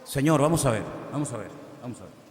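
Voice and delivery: low mutter trailing off, quick repeats at the end; hesitant servants. Acted. Servants doubting the Lord's order, muttered and fading. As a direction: grumble it under the breath, fading fast.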